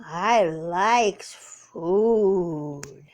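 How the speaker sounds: low and very slow, savouring the words. Pretend. Owner voicing her cat savouring its love of food, low and very slow. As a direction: draw it out like a content, lazy cat. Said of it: "He says".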